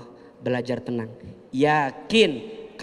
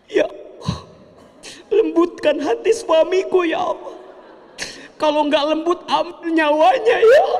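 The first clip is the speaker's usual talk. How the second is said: high, wailing hammed-up plea; mock desperate prayer; 13 semitones higher than usual. Wife praying to God about her husband, hammed-up wailing. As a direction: overdo a frantic wife begging God.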